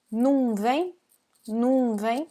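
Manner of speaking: "não" said as num before vem; "não vem" as num vem, rising; reduced não. Shown as a demonstration. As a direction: show "não" shrunk to one nasal u. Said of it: "recognize this sound".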